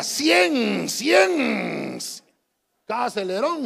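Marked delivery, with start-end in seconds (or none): 0.0-2.0 loud drawn-out cien like a revving engine; mock engine roar, slow and loud; shouted cien.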